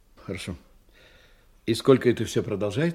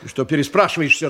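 fast, sharp, higher; irritated snap; 7 semitones higher, 4 dB louder, much faster than usual. Acted. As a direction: snap at him impatiently. Boss snaps at evasive man.

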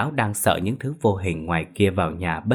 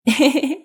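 a high burst of giggling; helpless laughter; 5 dB louder than usual. Acted. Son laughing at his father, high helpless giggle. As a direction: laugh at your father's wet trousers. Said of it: "mà cười ngặt nghẽo".

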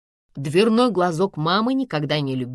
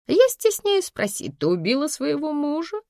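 casual inquiry; 9 semitones higher than usual. A narrator's reading. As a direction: ask as if about forecast snowfall. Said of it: "будто интересуясь обещанным метеосводкой снегопадом".

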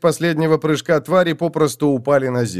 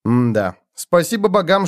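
usual speaking voice

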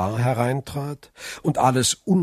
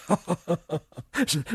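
higher chuckle in a put-on voice; light acted laugh; 5 semitones higher, 4 dB quieter than usual. A narrator's reading. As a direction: laugh it off in character. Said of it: "lachte Frau von Villefort". (gespieltes helles Lachen)

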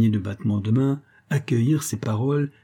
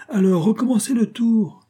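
very high crowd cry; shouting; 11 semitones higher, 4 dB louder than usual. A narrator's reading. Guests challenge the magician to repeat the trick, shouting. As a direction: demand it at the top of the voice. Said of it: "lui cria-t-on".

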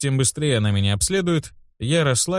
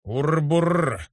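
rolled-r growling noise; imitated stomach rumble; 5 semitones higher than usual. A narrator's reading. Hungry boy's stomach interrupts, rumbling. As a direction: roll the r's like a rumbling belly. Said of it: "забурчал мой живот".

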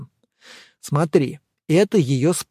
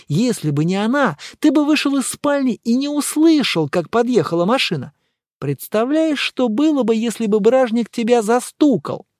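higher-pitched, animated dialogue voice; lively, higher character voice; animated friend; 7 semitones higher than usual. Acted. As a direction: voice an excitable friend in a raised pitch.

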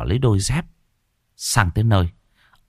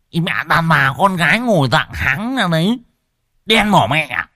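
lisping yell; 6 semitones higher than usual. Acted. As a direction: bark at her with a tongue-tied lisp. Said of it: "cất cái giọng ngọng líu ngọng lô quát". (quát ngọng líu ngọng lô)

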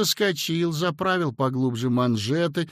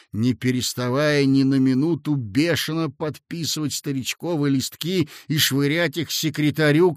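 ordinary delivery

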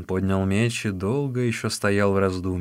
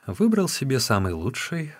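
ordinary delivery

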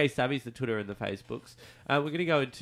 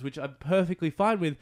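usual speaking voice

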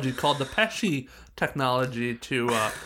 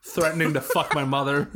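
ordinary talk, nothing marked

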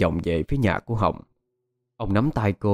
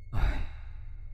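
soft, breathy sigh; weary sigh; 10 dB quieter than usual. A narrator's reading. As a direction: let out a tired sigh. Mother begins weary advice.

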